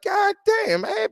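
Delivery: high, startled God damn; disbelieving yelp at what he's hearing; shocked exclamation. Retold or imitated. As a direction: jump way up in pitch. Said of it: "I'm like".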